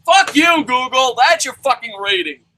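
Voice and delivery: high, loud comic fury; hammed-up irate customer; mock angry retort. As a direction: snap back at the squeaky employee. Acted angry customer answers the employee.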